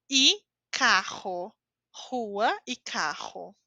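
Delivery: "rua" and "carro" with a throaty H-like R, slow; "Rua e carro" with the R breathed like H; strong R as H. Shown as a demonstration. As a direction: say "carro" as KA-hu, slowly. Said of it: "tem dois exemplos".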